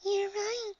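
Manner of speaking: tiny high voice with a shy laugh; agree, then titter softly; soft giggle. A narrator's reading. Shy pony agreeing with her friend, soft giggle. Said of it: "giggles Fluttershy".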